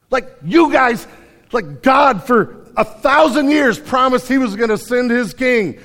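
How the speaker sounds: loud, high preacher's confrontation; shouted accusation. Acted. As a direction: call the crowd out at full voice, acting Peter. Acts Peter confronting the crowd.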